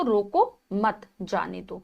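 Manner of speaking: slow रोको मत, a break, then जाने दो; pause after मत. Shown as a demonstration. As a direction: set जाने दो apart after a stop on मत. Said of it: "रोको मत के बाद अगर हम अल्प विराम". (मत के बाद विराम)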